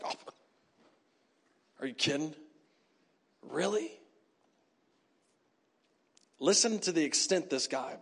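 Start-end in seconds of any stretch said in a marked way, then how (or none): 0.0-3.9 quiet, acted dismay; muted disbelief. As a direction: drop your voice, appalled at what you hear. Law teacher scandalised by Samaritan.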